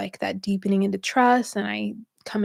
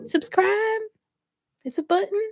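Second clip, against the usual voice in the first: playful plea; 11 semitones higher than usual. Real, not acted.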